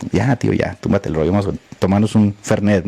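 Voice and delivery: conciliador, más grave y suave; tono conciliador. Retold or imitated. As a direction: calmar a un amigo tras la pelea. Imita una reconciliación amistosa. (a Jorge, invitándolo a un Fernet con calma)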